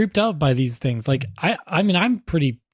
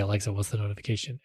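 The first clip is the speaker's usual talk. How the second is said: quiet command; 4 semitones lower, 9 dB quieter, faster than usual. Retold or imitated. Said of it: "And I can say".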